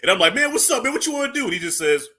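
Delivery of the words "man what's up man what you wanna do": high-pitched street challenge; acted challenge. Acting out stepping to somebody, high challenging voice. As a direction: square up with a raised, pushy voice. Acts out confronting a stranger.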